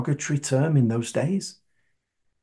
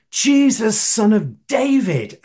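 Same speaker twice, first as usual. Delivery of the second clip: higher, drawn-out call; retold shout; 6 semitones higher than usual. Blind man calling out to Jesus past the crowd, retold shout. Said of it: "And he shouts".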